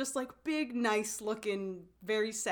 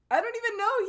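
mock tearful break; 14 semitones higher, 8 dB louder, faster than usual. Tearful boy in retold scene.

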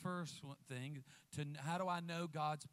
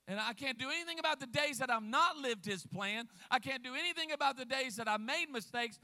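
full-force preaching; 5 semitones higher, 7 dB louder than usual. Acted. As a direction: preach it hard at the crowd. Preacher pressing the point.

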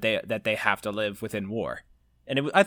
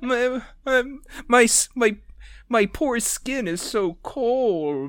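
higher, slow whiny complaint; 10 semitones higher, slower than usual. Real, not acted. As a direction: whimper about the cold skin. Complaining about the cold.

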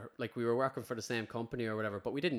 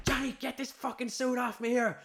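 much higher, louder mock panic; man yelling at his friend to get his wetsuit off, retold panic; retold panicked yell; 12 semitones higher, 4 dB louder than usual. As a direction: holler it like you're being stung. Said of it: "All you hear is".

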